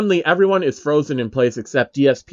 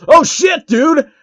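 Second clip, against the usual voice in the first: a much higher, loud startled shout; alarmed yell; 13 semitones higher, 8 dB louder, slower than usual. Acted. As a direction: yell as the attack comes at you. Heathcliff rushes at Phil.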